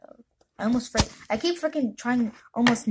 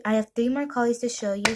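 ordinary delivery

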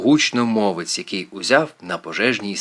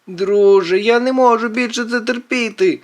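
high-pitched exasperated yell; fed-up outcry, pitch way up; 11 semitones higher, 4 dB louder than usual. A narrator's reading. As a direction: wail that you can't take it. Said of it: "волає Квінт".

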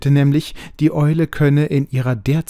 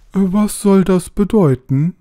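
a hoarse, startled, higher and louder question; 7 semitones higher, 6 dB louder than usual. A narrator's reading. Owl hears the bells strike, hoarse and alarmed. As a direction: rasp out the question sharply. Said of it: "mit heiserer Stimme".

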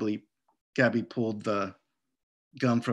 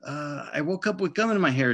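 sheepish mumble; 4 semitones higher than usual. Acted. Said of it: "she finally muttered".

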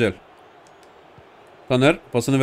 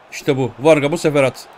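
ordinary talk

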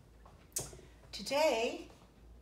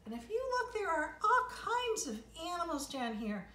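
excited; 8 semitones higher than usual. Real, not acted. Excited showing the picture.

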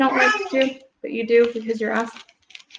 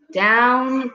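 ordinary delivery, nothing marked

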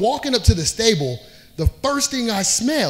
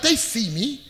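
nervous; 7 semitones higher than usual. Retold or imitated. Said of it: "I'm like".

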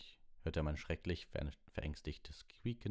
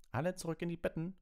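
high-pitched officious order; bossy prefect; 14 semitones higher, 3 dB louder, faster than usual. A narrator's reading. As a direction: order them to bed as prefect. Prefect orders everyone to bed.

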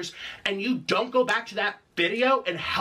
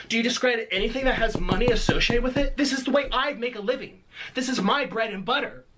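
ordinary delivery

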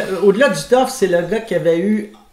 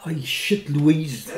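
[name]; 4 semitones lower, 8 dB quieter, much slower than usual. Acted. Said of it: "je vais te lancer sur une réplique".

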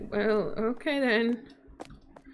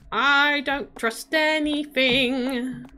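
slow wary; 3 semitones higher, much slower than usual. Real, not acted.

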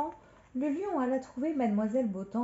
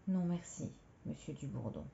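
soft, subdued reply, sinking lower; speaking too low; 3 semitones lower, 7 dB quieter than usual. Acted. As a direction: decline quietly, letting the voice drop away. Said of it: "Elle parlait trop bas".

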